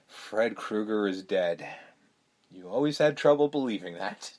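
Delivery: a hint of a dismissive father; cold father quote. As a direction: drop the voice and drag it out. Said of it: "one of my favorite lines".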